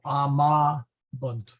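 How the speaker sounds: amabunt slowly split as a-MAA, pause, bunt; accent on long vowel. Shown as a demonstration. Teacher showing student the right accent, amabunt stressed on MA. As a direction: amabunt with MA stretched out. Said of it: "so we put it on the".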